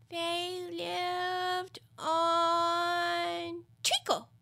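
high, very drawn-out creature voice; sleepy dormouse. Acted. Dormouse answers Alice, slow and dozy. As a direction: stretch the words while thinking them up.

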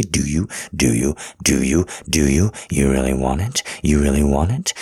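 low, driving, urgent questioning; insistent demand. Acted. Lover demands partner's answer, low and insistent.

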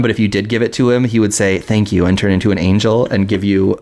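ordinary talk

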